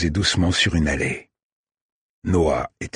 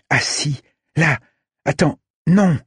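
alarmed; 8 semitones higher than usual. Acted. Violette spots shooter, panics.

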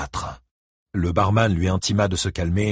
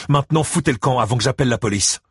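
fast, high, raised-voice order; barking; 5 semitones higher, 4 dB louder, faster than usual. A narrator's reading. Barman throwing out troublemakers with police threat, barking. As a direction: bark it out in one rush. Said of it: "aboya-t-il".

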